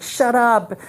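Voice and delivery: put-on fed-up teacher cutting the kid off; teacher snapping. Teacher snaps at arguing kid.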